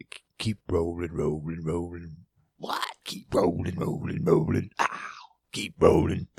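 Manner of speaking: playful gnarly chant. Pretend. Host goofing on keep rolling, in a gnarly voice. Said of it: "It sounded gnarly".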